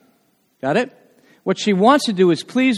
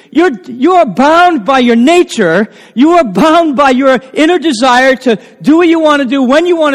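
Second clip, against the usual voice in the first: loud, high fervent preaching; full-voiced revival sermon; preaching at full force; 6 semitones higher, 7 dB louder than usual. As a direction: preach it with rising passion.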